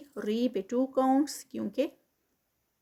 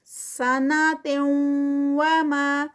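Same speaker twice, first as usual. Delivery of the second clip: higher, louder, slow recited phrase; Quranic recitation; 3 semitones higher, 6 dB louder, much slower than usual. Shown as a demonstration. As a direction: recite it as in prayer, stretching the vowels. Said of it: "تنوین ہے اور پھر آگے واو ہے". (بلند اور آہستہ تلاوت)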